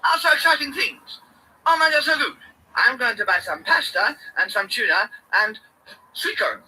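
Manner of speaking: high, loud showman hype; ding ding like a game-show bell; hyped announcer. Acted. Vlogger announcing the Asda trip, loud high hype.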